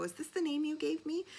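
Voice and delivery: high, eager question; excited. Retold or imitated. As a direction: ask it bursting with hope. Excited text to friend.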